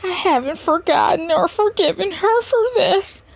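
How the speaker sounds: high-pitched mock-weepy whine; mock crying. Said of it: "you really crying, talking about".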